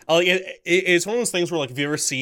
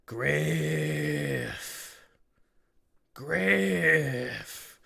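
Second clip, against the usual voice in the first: choked, painful; 3 dB quieter than usual. Acted. The creature calling Griff awake in pain, choked. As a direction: force the name out through a choked throat. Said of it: "it sounds, like, very choked".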